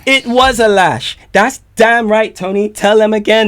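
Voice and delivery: shouting along with the track, high and loud; hyped shout. Real, not acted.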